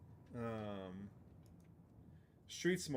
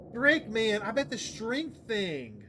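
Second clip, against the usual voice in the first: exasperated outburst; 8 semitones higher, 9 dB louder, slower than usual. Real, not acted. Regretting an earlier game choice.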